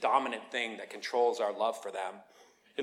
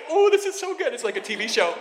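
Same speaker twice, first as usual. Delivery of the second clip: high, loud, swooping "oh" of relish; giddy spectator enjoying the show; gleeful delight; 11 semitones higher, 8 dB louder than usual. Retold or imitated. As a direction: squeal with delight, then gush. Retold delight at the quarrel.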